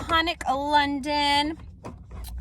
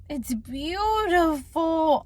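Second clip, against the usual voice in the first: teary awe; much slower than usual. Real, not acted. Admiring the new palette.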